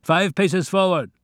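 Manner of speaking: high, loud commands through a loudspeaker, last line slow; loudspeaker orders. Acted. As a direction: bark camp orders over a tinny PA. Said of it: "Orders were given us through loudspeakers".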